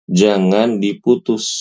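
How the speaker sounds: normal talking voice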